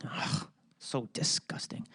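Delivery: a real whispered groan of disgust. Real, not acted. Host venting disgust at the double standard, whispered. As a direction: hiss it out, fed up. Disgusted aside after ranting.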